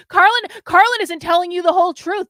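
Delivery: mocking her critics' warnings; mocking commenter. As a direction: parody the critics with a mock-earnest cry. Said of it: "people that are appealing to you guys saying".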